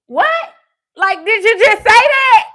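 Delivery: loud, high-pitched incredulous outburst; shocked disbelief. Retold or imitated. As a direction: blurt it out, utterly floored. Retold shocked reaction to friend.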